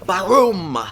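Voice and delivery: vocal missile blast; imitated explosion. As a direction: punch it out like a comic-book blast.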